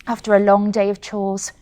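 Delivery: ordinary delivery, nothing marked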